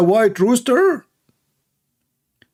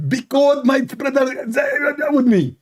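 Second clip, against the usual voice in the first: lightly raised mock debater voice; a hint of a parodied debater, higher; Zakir Naik; 7 semitones higher, slower than usual. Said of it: "Zakir Naik".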